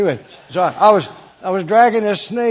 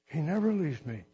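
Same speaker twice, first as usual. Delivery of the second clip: usual speaking voice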